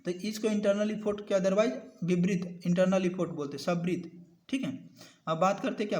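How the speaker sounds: normal talking voice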